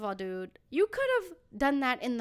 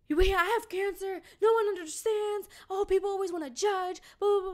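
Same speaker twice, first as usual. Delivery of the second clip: higher, whiny mock of his rant; mocking the ranting guy; 7 semitones higher, 4 dB louder than usual. Mocking the stranger's cancer rant, higher and whiny. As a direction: whine it like the guy. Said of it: "and be like".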